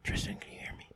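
breathy whisper into the mic; whispering on the phone. Real, not acted. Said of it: "I have to whisper it to you".

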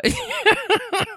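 high-pitched fit of giggles. Real, not acted. Storyteller remembering the lady, high giggle. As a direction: lose it briefly mid-sentence.

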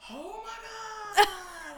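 slow, loud, high mock freakout; hammed-up Oh my God; bugging out. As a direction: drag out the shock like she's losing it. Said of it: "like, bugging out".

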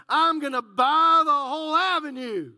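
loud, bragging proclamation; loud boast. Acted. Preacher boasting to the church about buying the avenue, loud. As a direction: shout it out like you just got rich.

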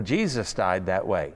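ordinary delivery

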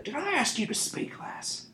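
high, sharp snap of a thug; snapping thief. A narrator's reading. Thief silencing the frightened girl, snapping. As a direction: cut her off with scorn. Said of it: "he snapped".

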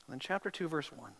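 ordinary talk

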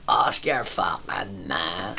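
slow, soft, dragged-out brush-off; slow dismissive mutter. Retold or imitated. Lad brushing off the drinker: slow soft dismissive drawl. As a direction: drawl it like he's out of comebacks. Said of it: "And he went".

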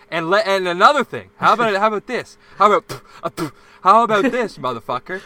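loud furious tirade; angry rant. Retold or imitated. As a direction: let rip at the boss, shouting. Retold tirade at the boss.

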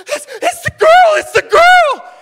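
high, loud thrilled shout of recognition; excited shout. Retold or imitated. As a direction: shriek it out with joy, very high. Lady on bus recognizing the girl.

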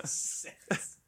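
breathy whispered "Sex"; whispered sexy word. Pretend. Host whispers "Sex" to his friends as a sexy joke. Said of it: "I said something sexy".

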